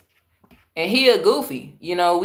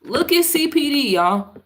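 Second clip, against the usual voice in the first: outraged exclamation; 7 semitones higher than usual. Real, not acted. Outraged at police in clip.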